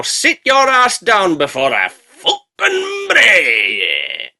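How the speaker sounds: slow, dragged-out furious shout; furious yell. Acted. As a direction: roar the threat word by word. Villain threat in recap.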